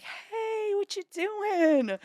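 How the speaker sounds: high, drawn-out singsong greeting; breezy fake-casual. Caller greeting her sister on the phone, breezy fake-casual.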